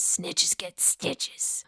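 slow, hushed warning; menacing whisper. Acted. Podcaster repeating the saying about Wendy, slow whisper. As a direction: whisper it slowly, drawing out each word.

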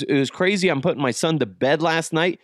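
usual speaking voice

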